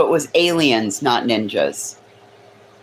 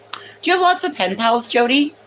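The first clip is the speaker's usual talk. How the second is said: high teasing voice; 10 semitones higher than usual. Real, not acted. Teasing question to Jodi.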